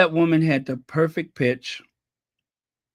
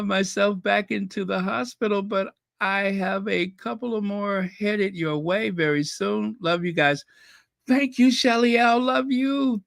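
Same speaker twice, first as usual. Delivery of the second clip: ordinary talk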